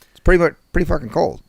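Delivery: ordinary talk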